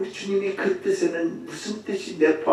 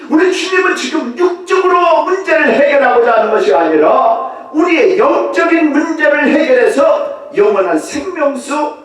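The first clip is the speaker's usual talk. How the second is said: loud, raised preaching; fervent preaching; 12 semitones higher, 12 dB louder than usual. Acted. Preacher to congregation, loud fervent preaching. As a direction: proclaim it to the hall.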